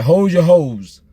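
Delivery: a mother's sharp order, imitated; imitating mother's order. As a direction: bark it like a stern mother. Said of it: "then she's like".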